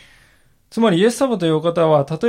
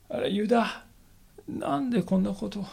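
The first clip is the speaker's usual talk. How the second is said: higher, softer grieving question; sorrowful; 5 semitones higher, 6 dB quieter than usual. Shown as a demonstration. Preacher voicing Jesus asking Judas in sorrow. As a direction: ask it with a hurt heart. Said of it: "悲しみを伝えられたかもしれませんね".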